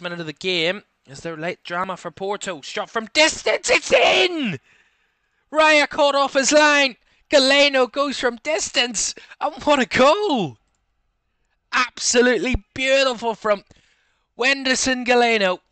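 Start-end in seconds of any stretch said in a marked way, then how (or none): 2.6-4.6 roaring, rising goal shout; goal call. Real, not acted. Last-minute goal call. 5.5-7.0 loud, slower excited commentary; excited shout. Real, not acted. Goal call, then replay. 7.3-15.6 loud, high thrilled commentary; excited goal call. Real, not acted. Last-minute goal call.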